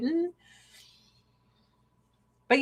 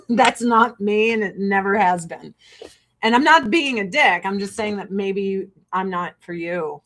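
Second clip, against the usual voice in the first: ordinary talk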